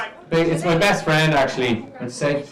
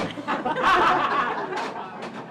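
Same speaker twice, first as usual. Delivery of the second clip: light laugh after the punchline; high laugh; 13 semitones higher, 5 dB quieter than usual. Real, not acted.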